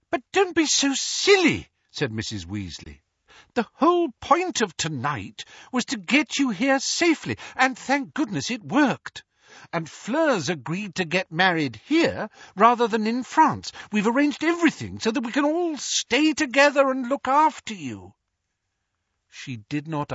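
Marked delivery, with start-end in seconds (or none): 0.1-1.7 higher, louder motherly scold; fussing mother voice. Acted. Mrs Weasley voiced, far timbre. 3.5-18.1 higher fussing mum; worried mother voice. Acted. Mrs Weasley voiced throughout.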